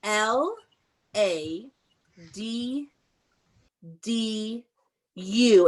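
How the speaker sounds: letter by letter, each one set apart; spelling it out. Shown as a demonstration. Teacher spells ladu for the children, letter by letter. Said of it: "let's do it".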